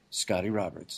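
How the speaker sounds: low, soft end of an announcement; imitated announcer. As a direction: drop down low and quiet on the name. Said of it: "That's how he always announces me".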